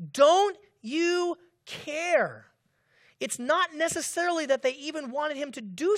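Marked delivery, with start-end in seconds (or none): 0.1-2.5 high, loud, slowly drawn-out plea; anguished cry. Retold or imitated. Disciples crying to the sleeping Jesus, high anguished plea. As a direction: let the hurt ring out slowly.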